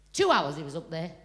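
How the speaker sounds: normal talking voice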